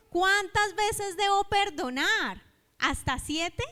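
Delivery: a lightly acted Peter asking in earnest; Peter's question. Peter voiced asking Jesus. (Pedro le pregunta a Jesús cuántas veces perdonar, voz más aguda y fuerte)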